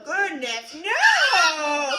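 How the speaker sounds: usual speaking voice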